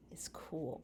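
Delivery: hushed, breathy secret; whispered. Acted. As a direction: whisper it like a teaser. Teaser whisper before reading.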